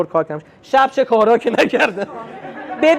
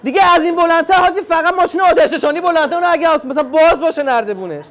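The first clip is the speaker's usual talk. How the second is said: mock outrage; 6 semitones higher than usual. Husband fuming to his wife over Hashem's taller car, mock outrage. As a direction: fume about the taller car. Indignant outburst about Hashem.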